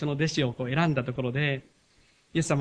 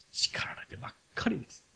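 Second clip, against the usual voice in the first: soft whispered aside; 6 semitones higher, 7 dB quieter than usual. Real, not acted. Pastor sympathizing with scolded disciples, whispered aside.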